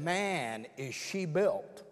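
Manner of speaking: soft, muttered aside to a friend; a teen boy's low, slow remark behind his hand; sneaking a comment past his mother; hushed aside. Retold or imitated. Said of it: "he turned to his friend and he said".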